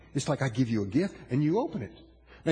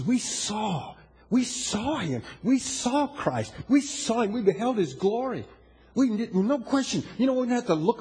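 booming motivational speech; 5 semitones higher than usual. Acted. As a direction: push the words out to the hall. Preaching at full force.

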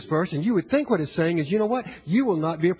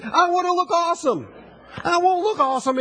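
put-on higher, little-girl whine; imitating a two-year-old girl; 8 semitones higher than usual. Grandpa imitating his granddaughter Jade, high little-girl voice. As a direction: mimic the toddler who wants to look awesome. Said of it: "That's what she said".

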